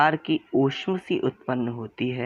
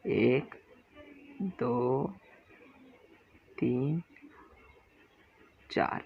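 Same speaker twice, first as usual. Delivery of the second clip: soft slow count; 6 dB quieter, much slower than usual. Shown as a demonstration. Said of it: "अब देखिए".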